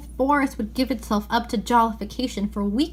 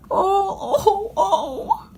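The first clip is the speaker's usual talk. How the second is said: high, crying oh's; sobbing cries; 10 semitones higher, much slower than usual. Acted. Faun sobbing over his guilt, high and crying. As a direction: break into sobs. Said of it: "sobbed Mister Tumnus".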